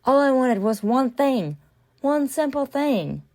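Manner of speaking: hammed-up irritation at the cold shower; imitating frustrated old self. Imitating her old irritated self over the ice-cold shower, mock exasperated. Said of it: "so irritated, so frustrated".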